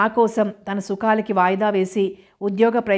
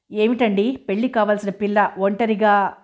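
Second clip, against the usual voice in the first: ordinary delivery, nothing marked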